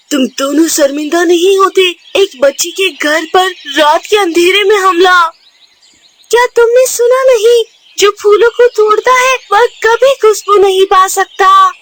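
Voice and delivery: high, loud reproach; scolding two bullies caught red-handed; angry scolding. Acted. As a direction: tell them off, hurt and indignant. Gauri scolds the attackers.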